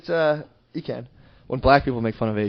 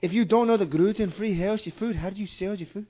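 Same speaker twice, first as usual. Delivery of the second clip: higher, badly faked Russian accent; mock Russian accent; 6 semitones higher, 3 dB quieter than usual. Prank caller quizzing the store, in mock Russian accent. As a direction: put on a thick supposed Russian accent. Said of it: "That was a Russian accent".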